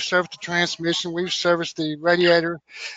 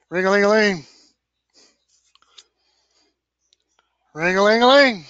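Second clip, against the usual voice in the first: sung-out mock phone ring, a bit louder; 5 dB louder than usual. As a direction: ring like a phone to open the call. Imitates phone ringing to start.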